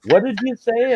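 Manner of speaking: high, loud teasing what did you say; playful high-pitched question; mock question. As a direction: tease the goose as if hard of hearing. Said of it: "and he'd go".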